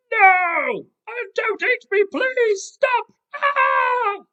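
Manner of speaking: very high, loud squealing plea; terrified pleading. Acted. Tiger begging crocodile not to eat her, shrieked pleading. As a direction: shriek and beg like a panicked cartoon tiger. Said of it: "she's pleading".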